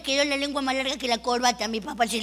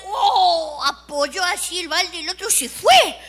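usual speaking voice

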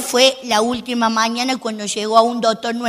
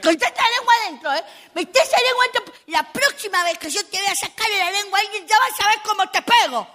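high, sharp mock scolding mother; 7 semitones higher than usual. Mother scolding her daughter for sticking out her tongue, high mock scold. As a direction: play the mom telling her girl off.

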